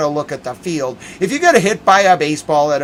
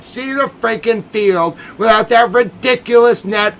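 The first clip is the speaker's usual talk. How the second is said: rising indignant yell; angry outburst; 7 semitones higher, 5 dB louder than usual. Real, not acted. Fan ranting to George about the net, angry. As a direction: voice jumps up and louder in outrage.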